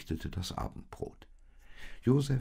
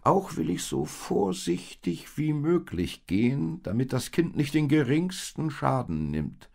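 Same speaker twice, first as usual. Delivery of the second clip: higher, louder donkey voice, reassuring; kind donkey; 10 semitones higher, 4 dB louder than usual. Acted. The donkey reassures the little animals before they sleep, higher. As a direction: promise to take great care.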